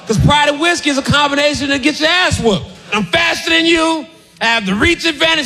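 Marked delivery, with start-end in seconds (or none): 2.9-5.5 mock boasting guard; cocky boast. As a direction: gloat like a champion.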